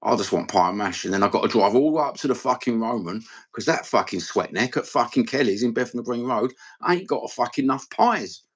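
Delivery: ordinary talk, nothing marked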